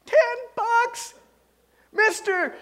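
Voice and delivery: high, loud, incredulous squeal on "Ten bucks"; can't believe the bargain; astonished buyer. Acted. Buyer stunned by the farmer's price, squealing high in disbelief.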